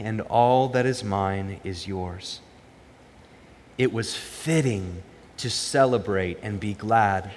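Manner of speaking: measured, solemn Bible reading to the congregation; scripture reading. Acted. Pastor reads the lost son parable, solemn and measured. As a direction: read the Gospel slowly, weighing each line.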